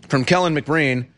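ordinary delivery

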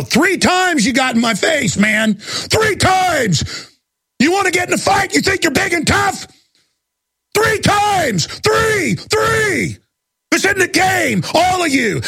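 high, shouted fury hammering "three"; real rage, slow and pounding; ranting shout. Real, not acted. Radio host scolding listeners, furious high shout. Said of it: "I'm ranting".

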